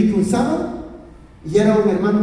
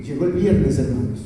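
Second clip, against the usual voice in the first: usual speaking voice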